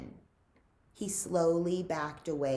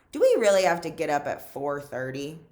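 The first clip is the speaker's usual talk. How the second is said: fast, louder complaining question; whiny quick question; 7 dB louder, faster than usual. Acted. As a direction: rush the complaint out. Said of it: "said Twitch".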